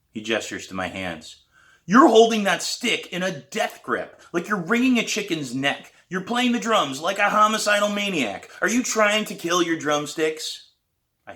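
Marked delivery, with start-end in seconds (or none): 1.9-10.7 higher, louder scolding; exasperated teacher. A narrator's reading. Teacher mocking the student's death grip, exasperated. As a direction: tease her grip with exasperation.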